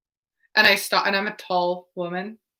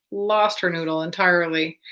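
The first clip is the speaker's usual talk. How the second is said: ordinary talk, nothing marked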